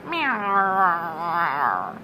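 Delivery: long low drawn-out cat meow; cat meowing at the muttering tailor; low night meow. A narrator's reading. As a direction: moan a cat's night meow. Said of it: "strange, horrid noises as cats do at night".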